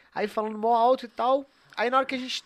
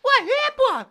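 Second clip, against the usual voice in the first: squeaky mock voice; 7 dB louder than usual. Imitating Bico's reply.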